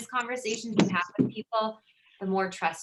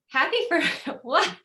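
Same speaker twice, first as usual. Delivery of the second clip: baffled laughing question; 8 semitones higher, 4 dB louder than usual. Retold or imitated. Baffled reply to neighbors' congratulations.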